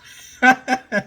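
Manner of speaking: loud, high burst of laughter; real laugh. Real, not acted. Woman amused at living the rich life, laughing loudly.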